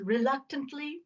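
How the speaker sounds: ordinary talk, nothing marked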